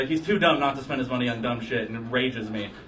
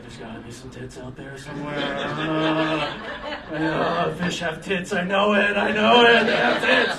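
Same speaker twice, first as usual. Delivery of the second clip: desperate sailor; 3 semitones higher, slower than usual. Acted. Thirsty sailor imagining.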